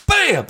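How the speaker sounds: sharp high bam sound effect; imitated punch bam. Telling friends about the punch, a high sharp bam. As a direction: snap out a punch noise, high and sudden. Wife punches him in back.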